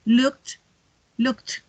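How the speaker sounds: "looked" as one syllable, released t at the end; strong final T. Shown as a demonstration. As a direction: end each "looked" on a hard T. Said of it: "la terminación debe ser más fuerte".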